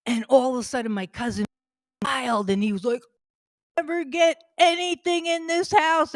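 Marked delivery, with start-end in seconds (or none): 3.8-6.1 temper tantrum. Retold or imitated. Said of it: "he had a big temper tantrum".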